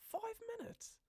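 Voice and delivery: very high, squeaky disbelief; shriek-pitched questioning repeat; incredulous. Real, not acted. Incredulous repeat of five minutes.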